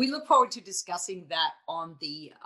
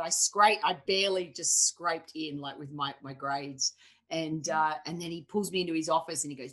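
ordinary delivery

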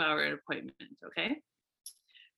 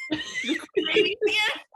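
strained high voice; 4 dB louder, much slower than usual. Retold or imitated. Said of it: "sharpest tone ever".

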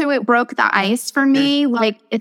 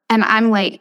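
normal talking voice

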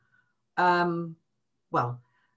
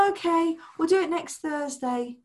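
higher, airy mock promise; lightly voiced careless partner; breezy brush-off; 10 semitones higher than usual. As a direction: toss it off lightly. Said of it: "innocent brushing off finances".

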